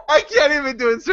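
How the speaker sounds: high-pitched, helpless giggly protest; laughing too hard. Real, not acted. Podcaster giving up on a British accent, laughing too hard. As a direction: squeak it out through real laughter. Cracking up over failed accent attempt.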